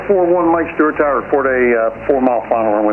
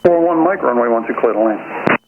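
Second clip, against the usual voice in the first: air traffic control radio. Real, not acted. Tower landing clearance over radio.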